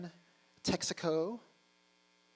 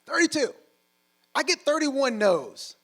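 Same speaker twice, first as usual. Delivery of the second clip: emphatic punchline; 11 semitones higher, 11 dB louder than usual. Real, not acted. Punchline about NFL rejections.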